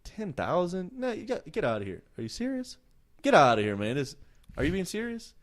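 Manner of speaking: a husband who can't believe the offer; mock shocked husband; stunned disbelief. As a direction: pitch jumping high in disbelief. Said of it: "he starts to go".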